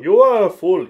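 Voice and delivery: high, loud mock shout; mock screaming. Co-host joins the characters' screaming match, mock shouting. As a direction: yell it back, hammed up. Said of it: "they're fucking screaming at each other".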